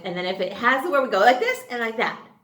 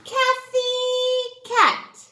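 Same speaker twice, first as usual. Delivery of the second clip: high, slow singsong "Kathy Cat"; cartoon character name; 14 semitones higher, 5 dB louder, much slower than usual. Shown as a demonstration. Teacher introducing the Kathy Cat character, high and slow. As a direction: present the puppet cat grandly. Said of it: "This is".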